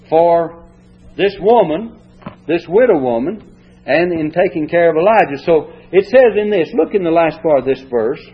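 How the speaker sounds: ordinary delivery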